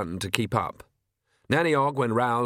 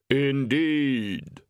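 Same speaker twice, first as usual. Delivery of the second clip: slow, hollow voice of Death; Death's voice; 2 semitones higher than usual. Acted. Death answering Granny in the dark, grave and hollow. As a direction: speak as Death, unhurried and grave.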